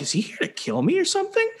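high, quick, mock-nervous question; worried. Man facing a giant escaped rabbit, worried. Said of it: "I'm actually kinda worried".

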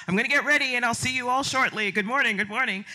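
lightly voiced Oprah, quick and breezy; Oprah impression. Oprah heading off to get ready, light breezy impression. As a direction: breeze through it like the host on her way. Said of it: "and she's like".

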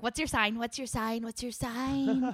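slow, drawn-out eager question; nosy old lady voice. Retold or imitated. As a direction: press like a nosy neighbor. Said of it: "they're all like".

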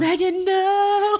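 high, long-drawn pleading no; mock dismay. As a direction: plead in exaggerated dismay, stretching it out. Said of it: "And I was like".

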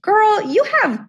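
high, incredulous pep talk to herself; sassy self-talk. Retold or imitated. Telling herself off in the scorching car, sassy and amused. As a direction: scold yourself, then hype the car up with a laugh.

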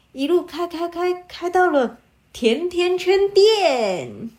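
usual speaking voice

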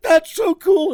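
very high, loud mock thrill; put-on high fanboy voice; awestruck gushing. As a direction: imitate a thrilled buddy squealing. Retelling the awestruck buddy again.

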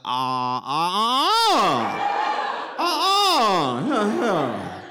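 high gagging ah's breaking into laughing cries; gagging yelp. Retold or imitated. Patient reacting to a cough in mouth, high gagging yelps. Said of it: "but I was like".

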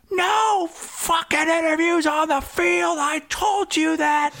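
high, slow furious mock yell; angry shout. Staffer repeating threat to reporter, high slow mock yell. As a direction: lay down the law, enraged. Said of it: "and he goes".

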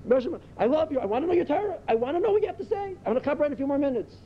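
high, eager plea to God; acted fervent longing to learn; with excitement. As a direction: pray it with geschmack, climbing in pitch. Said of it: "Say it with excitement".

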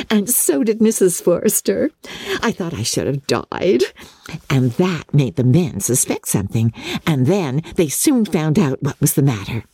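put-on high, giggly, breathless girl gushing; giddy young Lydia. A narrator's reading. Lydia gushing to her sisters in a high giddy voice. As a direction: gush like a silly teenage girl full of gossip.

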